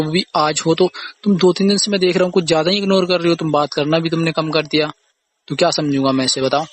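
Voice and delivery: normal talking voice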